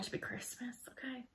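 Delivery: whispered little confession; playful whisper. Real, not acted. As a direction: drop into a whisper, as if sharing a secret. Playful confession of wanting Christmas early.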